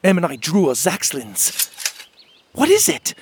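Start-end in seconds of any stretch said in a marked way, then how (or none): 2.5-3.1 loud, very high alarmed question; crying out. A narrator's reading. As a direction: call out in sudden alarm. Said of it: "Winnie cried".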